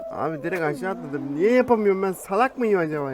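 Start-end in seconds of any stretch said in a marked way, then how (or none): 1.4-3.1 loud, higher, exasperated complaint; frustrated outburst. Real, not acted. Gamer venting loudly after falling again. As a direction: vent at yourself.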